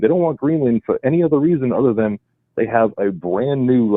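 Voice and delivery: usual speaking voice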